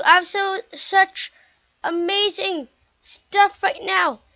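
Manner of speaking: high, loud, slowed excitement; excited gamer bragging about loot; thrilled. Real, not acted.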